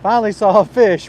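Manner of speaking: happy the wait is over; excited. Real, not acted. Finally sees a fish.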